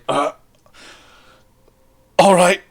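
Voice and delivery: hesitate, then blurt it out, a bit rattled; freaking out. Acted. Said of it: "freaking out. He's like".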